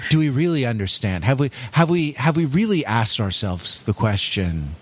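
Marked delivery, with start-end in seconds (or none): 2.1-4.8 radio voice. Pretend. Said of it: "figure out a radio voice".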